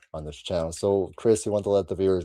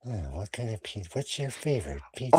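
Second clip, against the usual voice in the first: quiet, quick creepy phone voice; a hint of a killer taking orders; Ghostface voice; 3 semitones higher, 6 dB quieter than usual. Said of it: "he answered as his voice".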